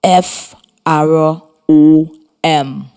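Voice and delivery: very slow letter-by-letter spelling. Shown as a demonstration. Teacher spelling a word to the class, letter by letter. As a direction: say each letter slowly and separately. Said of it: "is spelled".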